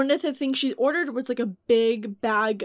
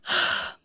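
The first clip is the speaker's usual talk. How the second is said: audible exhaled sigh; mock a put-upon sigh before explaining; weary sigh. Customer's mother about to excuse her daughter, weary sigh.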